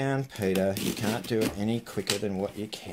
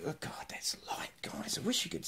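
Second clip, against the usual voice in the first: much softer whispered amazement; hushed whisper to the viewers; awed whisper; 5 semitones higher, 10 dB quieter than usual. Real, not acted. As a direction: breathe it out in disbelief. Awed by the parcel's weight.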